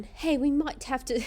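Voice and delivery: put-on British accent. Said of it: "I'll do a British one".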